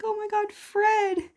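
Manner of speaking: high, drawn-out mock cry of dismay; imitated shocked squeal, stretched out; retold alarm. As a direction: re-enact finding a pet in peril. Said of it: "and I'm like".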